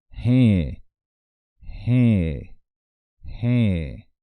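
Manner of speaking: "je" held long and loud, falling; drawn-out pronunciation. Shown as a demonstration. Teacher showing how to prolong "je", drawn-out and loud. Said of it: "Hay que prolongar un poco la pronunciación".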